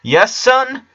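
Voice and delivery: bright high sing-song reply; cheery dad. A narrator's reading. Two dads poking out of the kitchen, answering cheerily. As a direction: answer like an eager happy father.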